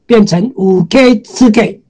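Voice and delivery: usual speaking voice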